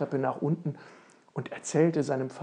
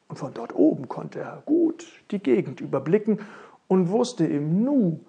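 ordinary delivery

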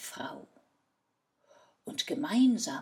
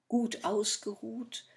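ordinary delivery, nothing marked

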